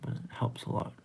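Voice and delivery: whispered aside. Real, not acted. Streamer whispering an aside to chat. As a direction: drop to a breathy whisper.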